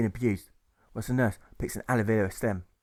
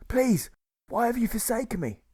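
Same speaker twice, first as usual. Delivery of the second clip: desperate plea; 11 semitones higher than usual. Acted. Dying man pleads to heaven.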